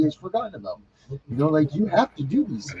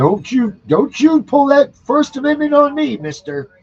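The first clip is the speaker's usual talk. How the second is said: loud, high barking cop telling him off; mock angry cop; 10 semitones higher, 8 dB louder, slower than usual. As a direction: snap at him like an outraged officer. Cop scolding a citizen, acted.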